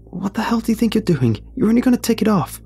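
high, urgent reproach; panicked scolding. Acted. Panicked scolding of Josh near bear.

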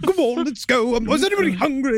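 high, fussy put-on officer voice; mock captain. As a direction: play a prissy captain rallying the men. Mock captain readying his men.

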